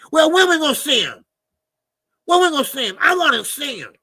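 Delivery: high, loud fan begging to see Tank; impatient whining. Real, not acted. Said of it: "I'm like a big old baby".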